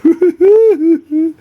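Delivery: sudden high burst of laughter; laughing at the emptying whisky bottle, high laugh. Real, not acted. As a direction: laugh out loud, high and bright. Laughs at bottle getting lower.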